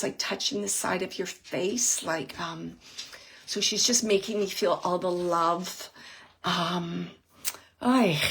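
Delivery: normal talking voice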